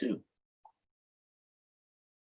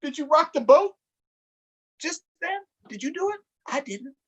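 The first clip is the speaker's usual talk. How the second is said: nervous questioning; 12 semitones higher, faster than usual. Acted. Boat rocks, asking the kids.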